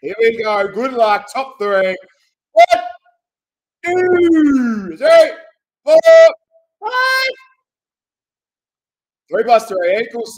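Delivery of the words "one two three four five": counting much higher and louder, shouting each number; excited count. Real, not acted. Host counts the dice rolls for the viewers, shouting. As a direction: call each number out as it lands.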